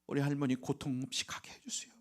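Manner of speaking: breathy whisper, voice choked with grief; hushed grieving whisper; whispered prayer. Retold or imitated. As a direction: whisper it like praying at a deathbed. Tearful deathbed prayer retold.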